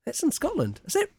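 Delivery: high, soft puzzled disbelief; disbelieving wonder. Retold or imitated. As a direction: ask it high, half to yourself. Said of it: "I was sitting there going".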